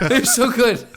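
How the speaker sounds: high, laughing gush; laughing praise. Real, not acted. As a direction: squeal it through a laugh. Gushing over the actors.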